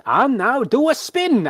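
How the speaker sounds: high, loud, stretched-out cue; showman's command. Acted. Ringmaster orders the bear to spin, theatrical call. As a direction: announce the trick with a ringmaster's flourish.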